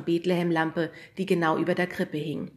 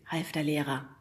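ordinary delivery

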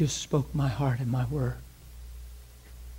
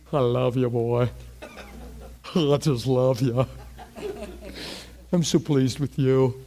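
God's voice; 2 semitones lower than usual. Retold or imitated. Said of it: "God Almighty's laying on top of you ... saying".

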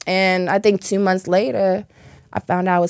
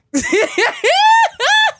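a high, loud burst of laughter; loud shrieking laugh; 5 dB louder than usual. Real, not acted. Friend teases Baltimore men, big shrieking laugh.

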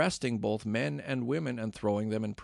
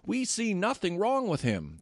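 Pharisees shouting; 9 semitones higher than usual. A narrator's reading. Pharisee teachers defending Paul in the uproar, shouting. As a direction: cry out in his defense. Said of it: "they shouted".

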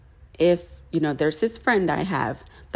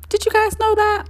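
sarcastic high aside; 4 dB louder than usual. Retold or imitated.

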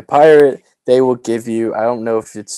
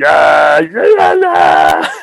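pirate shout; 2 semitones higher, 6 dB louder, much slower than usual. Pretend. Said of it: "With the hook".

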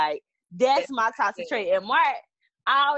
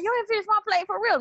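husband in disbelief; 11 semitones higher than usual. Retold or imitated. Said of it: "And he be like".

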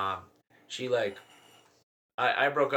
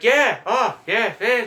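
high, loud mock enthusiasm; acted-out excitement; 10 semitones higher, 10 dB louder, much slower than usual. Acting out the girlfriend story.

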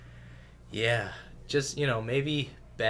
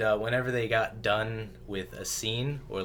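ordinary talk

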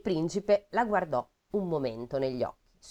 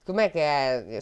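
ordinary talk, nothing marked

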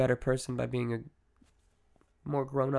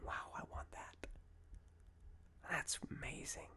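barely audible, hushed wonder; awed whisper; 19 dB quieter than usual. Acted. Imagined onlooker admiring the listener's life, in an awed whisper.

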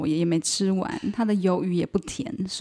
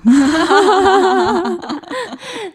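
loud laughing fit; 10 semitones higher, 6 dB louder than usual. Real, not acted. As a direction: burst out laughing hard. Big laugh after joke.

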